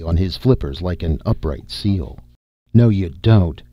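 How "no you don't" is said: louder, sharp commanding bark; barked order. A narrator's reading. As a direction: stop him with a sudden loud bark. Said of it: "he barked".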